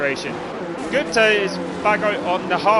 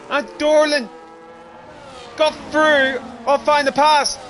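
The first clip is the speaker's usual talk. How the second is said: higher, building race commentary; excited play-by-play; 6 semitones higher than usual. Acted. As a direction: call the battle as it happens.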